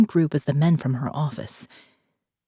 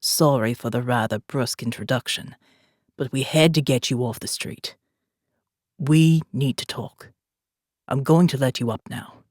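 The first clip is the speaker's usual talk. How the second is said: put-on calm commander, a light South African accent; faint South African accent; 5 semitones lower than usual. A narrator's reading. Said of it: "faint South African accent in his deep tone".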